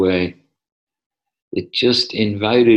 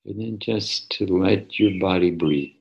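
soft, unhurried meditation voice; soft spa whisper; 3 dB quieter than usual. Acted. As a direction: ease them gently into stillness. Leads a guided meditation.

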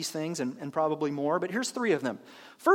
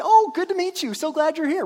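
much higher, louder bubbly welcome; cheerful greeter; 8 semitones higher, 7 dB louder than usual. Retold or imitated. Pastor playing the welcome table greeter, beaming and loud. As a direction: greet newcomers with bright cheer. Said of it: "cheerful and morning and happy".

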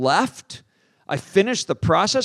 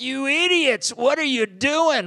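higher, slower mock crowd asking in disbelief; incredulous crowd; 8 semitones higher than usual. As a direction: play the stunned audience. Crowd staring at the preachers.

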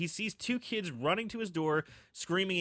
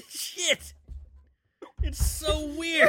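laughing; 9 semitones higher, much slower than usual. Real, not acted. Still laughing.

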